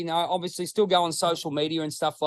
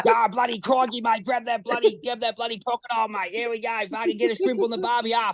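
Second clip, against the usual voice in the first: higher, hammed-up Aussie crocodile-hunter voice; Steve Irwin; 4 semitones higher than usual. Australian playing up his Irwin likeness to podcast friends, hammed-up impression. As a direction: do a mock crocodile wrangler, pitched up. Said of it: "You have the voice".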